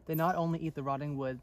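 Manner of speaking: ordinary delivery, nothing marked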